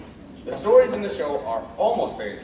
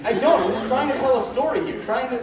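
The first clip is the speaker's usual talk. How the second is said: 1 defensive protest; 6 semitones higher, 3 dB louder than usual. Acted. Arguing back with the chicken.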